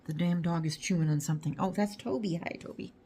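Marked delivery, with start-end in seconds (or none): 2.4-2.9 soft unvoiced hello; whispered greeting. Real, not acted. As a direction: breathe a hello to the pup. Greeting the other dog.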